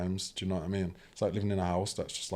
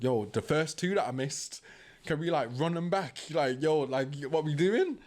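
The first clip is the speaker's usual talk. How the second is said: eager pleading; 8 semitones higher than usual. Retold or imitated. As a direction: stretch out the last words like a pleading kid. Begging counsellor to rerun sessions.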